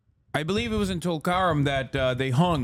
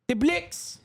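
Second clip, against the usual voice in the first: put-on retry of the South African accent, pitched up high; bad South African accent; 11 semitones higher than usual. Streamer retrying the line, bad South African accent. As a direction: try the South African accent once more, badly. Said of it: "I can't do a South African accent".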